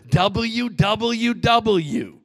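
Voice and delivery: very slow W W W, letter by letter; ominous spelled out. Real, not acted. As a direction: draw each W out like a warning.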